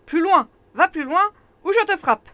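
cri aigu et très fort; crié. Acted. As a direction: hurle la menace de loin. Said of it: "la sorcière se retourne et crie". (la sorcière crie à l'écuyer caché)

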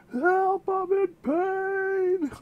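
high, stretched-out whine for the aching knees. Retold or imitated. Knees complaining to their owner, high pained whine. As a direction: play the knees crying out in comic pain, pitched high. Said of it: "my knees like".

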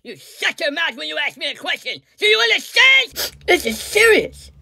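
scolding rant. Acted. Pelosi scolding the reporter, a high angry rant. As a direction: tear into the reporter.

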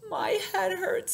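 high, slow pitiful voice; a small hurt whine, stretched out; sad little elephant. A narrator's reading. Little elephant after bumping the tree, sadly. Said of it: "says Maya sadly".

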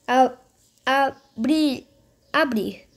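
ordinary talk

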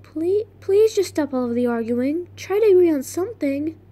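acted plea to end a quarrel; plead, pitching up toward the end; Charlie. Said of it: "Mm, Charlie".

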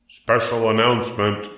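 pompous fairground announcer. A narrator's reading. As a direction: announce it with self-important ceremony. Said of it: "in a pompous voice".

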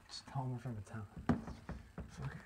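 ordinary delivery

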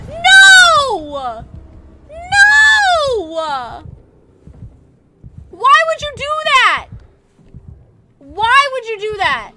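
high, loud panicked yelling; panicked screaming. Real, not acted. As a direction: scream at the attacker in terror. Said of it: "random frights from your screams".

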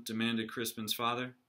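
plain reading voice

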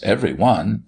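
ordinary delivery, nothing marked

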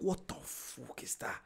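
whispered, slow and appalled; shocked whisper. Retold or imitated. First smelling the stink bomb at school, slow shocked whisper. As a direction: breathe it out in disgust. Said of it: "I'm like".

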